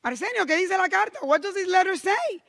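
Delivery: a mother begging to know what the letter says; anxious mother. Retold or imitated. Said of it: "she goes".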